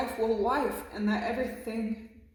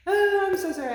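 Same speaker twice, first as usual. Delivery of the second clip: sudden, much higher and louder apology; a reader suddenly overcome with feeling, crying out an apology; emotional outburst; 11 semitones higher, 10 dB louder, faster than usual. Real, not acted. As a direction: burst out, overwhelmed with emotion. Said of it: "I'm an emotional mess right now".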